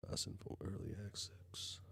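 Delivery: whispering. Real, not acted. Reading chat under breath.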